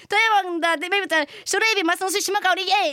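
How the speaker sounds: rushed stage version. Retold or imitated. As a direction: blurt it all out and cheer. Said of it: "本番になって".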